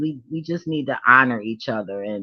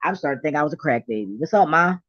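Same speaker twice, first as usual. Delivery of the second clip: normal talking voice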